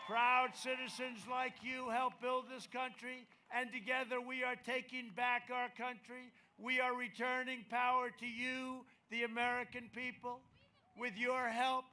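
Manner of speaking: soaring patriotic rally climax; rally speech. Acted.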